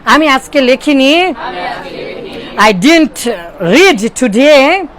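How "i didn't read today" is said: very slow, every word drawn out; slow teaching drill. Shown as a demonstration. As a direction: dictate to the class word by word. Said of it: "বলেন didn't". (খুব ধীরে, শব্দে শব্দে)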